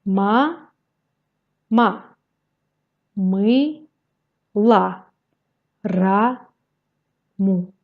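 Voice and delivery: Ma, ma, мы, la, раму, each syllable on its own with a pause after it; teacher's spaced-out syllables for beginners. Shown as a demonstration. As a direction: read slowly, one syllable at a time. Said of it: "syllabe par syllabe".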